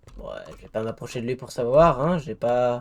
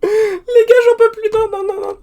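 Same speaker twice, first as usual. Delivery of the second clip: panicked whine; 12 dB louder than usual. Real, not acted. Overwhelmed by mobs.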